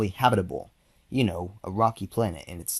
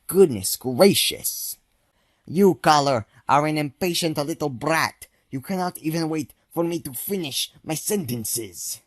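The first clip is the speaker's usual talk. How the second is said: raised, higher huffy scold; indignant scolding; 6 semitones higher, 5 dB louder than usual. Acted. Doctor Spud scolds caller.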